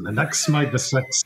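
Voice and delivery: slow, drawn-out mock Kiwi accent. Teasing the host by mimicking his Kiwi accent. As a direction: echo the host's line in a Kiwi accent. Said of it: "I do love the way you say that in your Kiwi accent".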